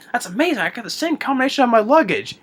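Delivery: high-pitched, gushing impression of astonishment; mock amazement. Retold President hears the combination, comically amazed. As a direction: act delightedly amazed, hammed up.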